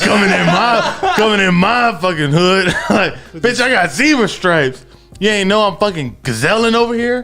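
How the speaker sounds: high, loud laughing thug voice; tough gazelle. Retold or imitated. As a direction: swagger in like a gangster.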